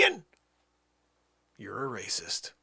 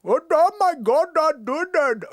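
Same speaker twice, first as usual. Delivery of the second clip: put-on loud Indian accent swooping high across notes; Indian accent, scale-sliding; 5 semitones higher, 7 dB louder than usual. Demonstrating the forty-five note scale, loud swooping Indian accent. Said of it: "like, the forty-five note scale".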